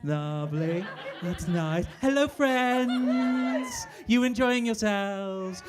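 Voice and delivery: drawn out very slowly, sing-song greeting; odd slow voice. Acted. Karaoke host greeting the singer's friends, mock slow crooning. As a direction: stretch each word like a cheesy host. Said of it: "I don't know why I'm talking like this".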